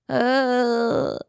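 weak guttural uh instead of a scream; feeble strangled scream. Retold or imitated. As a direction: try to scream and only a small uh escapes. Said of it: "which came out as".

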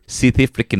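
lifted, exaggerated South African vowels; South African accent. Shown as a demonstration. Host boasting his accent beats a rival's, South African accent. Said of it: "appreciate when you hear this that my South Afri".

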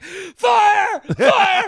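mock alarmed yelling. Frank reacting to fog under the door, yelling. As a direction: yell it like a panicked fool. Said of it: "when Frank's like".

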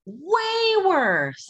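WAY stretched out high, falling on "worse"; "Way" soaring up, then dropping; emphatic exclamation. Real, not acted. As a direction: hit "Way" high and hold it. Emphatic answer about pain versus labor.

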